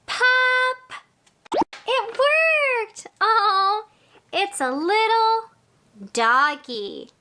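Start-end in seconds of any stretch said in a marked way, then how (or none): 0.1-1.0 higher, emphatic "Pop"; louder pop. Shown as a demonstration. Coaching children to say it louder, emphatic pop. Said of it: "Let's say it louder". 1.9-3.1 high, excited exclamation; delighted surprise. Pretend. Teacher showing children the pop worked, excited. Magic word reveals the toy.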